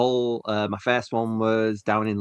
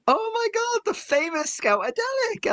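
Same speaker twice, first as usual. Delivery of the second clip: high, excited gushing greeting; starstruck fan. Retold or imitated. German scout greeting the podcaster, high and starstruck. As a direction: gush like meeting a celebrity. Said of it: "his first words to me were".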